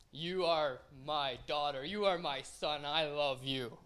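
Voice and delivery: soft, slow, solemn divine voice; voice from heaven. Retold or imitated. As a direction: speak slowly like God from above.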